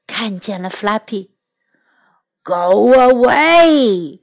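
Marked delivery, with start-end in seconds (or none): 2.4-4.2 slow, loud roaring dragon; dragon fire roar. Acted. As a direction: roar it out like an angry dragon mother. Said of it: "she roared".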